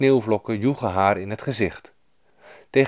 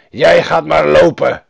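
angry robbers; 5 semitones higher, 10 dB louder than usual. A narrator's reading. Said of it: "boze rovers".

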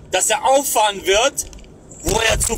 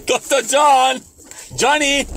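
calling out; 4 semitones higher, slower than usual. Real, not acted. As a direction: shout for him to come over. Calling out for Doktor John.

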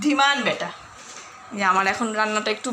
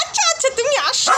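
coaxing dog; 12 semitones higher than usual. Real, not acted.

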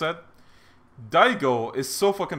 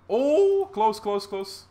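excited; 9 semitones higher, 4 dB louder, much slower than usual. Real, not acted. Enemy almost dead.

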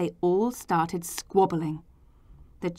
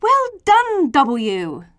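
high, loud congratulation, then dropping; cried out; 7 semitones higher, 6 dB louder, slower than usual. A narrator's reading. Children congratulating W, loud cheering cry. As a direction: sing out the praise, starting high. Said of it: "The children cried".

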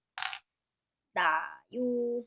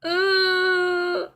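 pained cry; 7 semitones higher, 10 dB louder than usual. Acted. Hit by the invisible girl.

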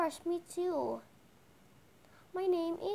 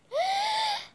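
high, sharp gasp of amazement; delighted gasp; 5 dB louder than usual. Pretend. Shy pony seeing a baby dragon, amazed gasp. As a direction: suck in air in wonder.

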